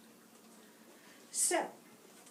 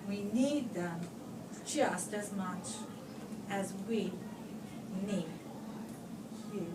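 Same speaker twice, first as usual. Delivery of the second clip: tender ending; 3 semitones lower, 8 dB quieter than usual. Acted. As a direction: land the moral gently to the kids. Softly ending the story.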